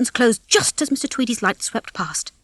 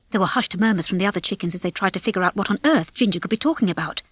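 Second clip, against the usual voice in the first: ordinary delivery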